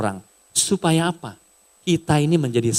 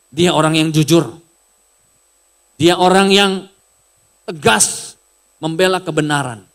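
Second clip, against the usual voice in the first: raised, louder, earnest praise; quoting a religious leader; 4 semitones higher, 5 dB louder than usual. Retold or imitated. Religious leader urging his followers, raised and earnest. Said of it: "Dia bilang begini".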